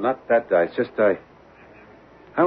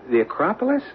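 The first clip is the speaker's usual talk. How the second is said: high, startled question; shocked she wants to go there; surprised; 12 semitones higher than usual. Acted. Husband startled by wife's plan, high surprised question.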